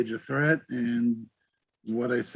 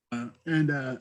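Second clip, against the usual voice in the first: usual speaking voice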